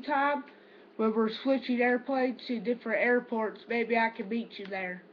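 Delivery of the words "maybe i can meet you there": ordinary talk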